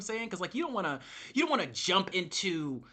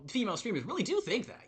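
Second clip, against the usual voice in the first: ordinary talk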